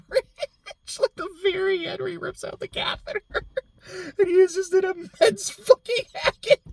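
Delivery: high-pitched laughing through the words; cracking up while trying to talk. Real, not acted. Laughing as she describes the catheter scene to viewers.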